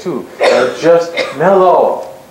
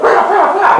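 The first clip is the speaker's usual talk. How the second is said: high mock cheering; mock rah-rah cheer; 13 semitones higher than usual. Boss mocking the team's fired-up reaction with a mock cheer. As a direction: pump it up like a pep rally, tongue in cheek. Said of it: "we're all like".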